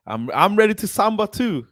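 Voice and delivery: suddenly high and loud, bursting with excitement; a fan who can't contain himself; excited. Real, not acted. Fan ready to celebrate, excited high burst.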